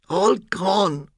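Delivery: high-pitched despairing wail; frantic muttering. A narrator's reading. Postman seeing job and pension lost, frantic. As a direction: let it rise into a high lament. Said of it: "Quigley muttered frantically".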